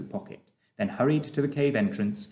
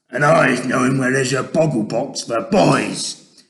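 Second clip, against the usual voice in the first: loud gloating giant voice; hungry monster's brag; greedy giant; 3 semitones higher, 10 dB louder than usual. Acted. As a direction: shout out a nasty boast. Gizzardgulper shouting.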